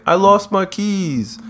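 high, drawn-out mock plea; mock-pitiful whine for help; helpless tenant. Mimicking tenants pestering the managers.

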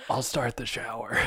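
soft, sheepish afterthought; quiet comic resignation; mock post-climax mumble. Shown as a demonstration. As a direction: say it hushed after a pretend climax. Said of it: "Mine, mine goes".

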